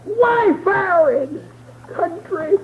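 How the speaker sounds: high crying shout, slowing down; preaching climax. Acted. Preacher whooping the reunion to the church. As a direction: whoop it out as they run together.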